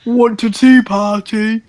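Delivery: slow, put-on Aussie muscleman child; Australian accent. Podcaster as muscly Hemsworth in pigtails inviting Corey, slow Australian accent. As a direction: drawl the invitation in mock Australian. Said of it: "in her Australian accent".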